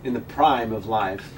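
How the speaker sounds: deadpan Walken cadence; Christopher Walken impression. Continues Walken bit.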